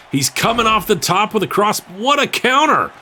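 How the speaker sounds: rising excited call; excited commentator. Real, not acted. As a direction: call the counter with rising hype. Play-by-play call of a counter.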